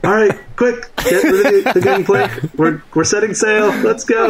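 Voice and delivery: high-pitched, urgent mock shout; shout to the captain. Character urging the captain to set sail, mock shouting. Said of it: "I shout to the captain".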